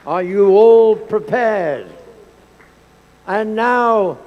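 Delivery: ordinary talk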